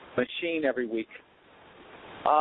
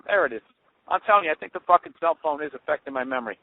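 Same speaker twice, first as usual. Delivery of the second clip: ordinary talk, nothing marked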